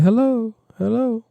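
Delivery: high hello with one echo; short echo hello. Shown as a demonstration. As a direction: say hello with a short delay tail. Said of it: "right here to be like".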